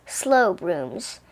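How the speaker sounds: ordinary delivery, nothing marked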